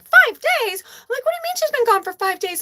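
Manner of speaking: high, loud, fast panicked question; shrieking disbelief at the news; shocked disbelief. Retold or imitated. Shocked reply, freaked out.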